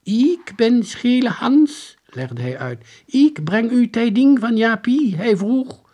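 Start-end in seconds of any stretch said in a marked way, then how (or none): 0.0-2.0 high, loud, slow declamation; stiff, drawn-out proclamation; strange soldier. A narrator's reading. Strange soldier introduces himself. 3.1-5.9 high, loud herald voice; strange soldier. A narrator's reading. Strange soldier delivers his message.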